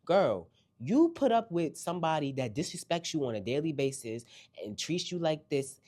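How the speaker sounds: ordinary delivery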